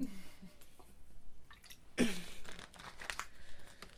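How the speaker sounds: soft airy laugh; quiet laugh with voice gone; breathy laugh. Real, not acted. Laughs about losing her voice.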